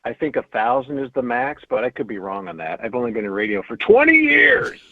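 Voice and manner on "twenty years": high, loud comic protest; mock indignation. Radio veteran joking about expertise, loud mock-indignant. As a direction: build to an exclaimed TWENTY YEARS. Mock boast after admitting doubt.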